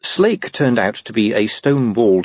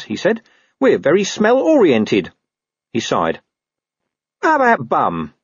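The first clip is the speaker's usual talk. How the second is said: higher, wry talking dog; cheeky mongrel explaining; Gaspode dog voice; 6 semitones higher than usual. A narrator's reading. Gaspode speaks again.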